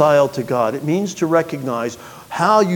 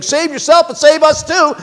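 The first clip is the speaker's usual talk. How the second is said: mocking rail; 11 semitones higher, 6 dB louder than usual. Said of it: "railing on the Lord".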